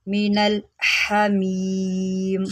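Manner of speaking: slow chanted tajweed; Quran recitation. Shown as a demonstration. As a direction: draw out Hamim in a recitation tune. Recites the verse.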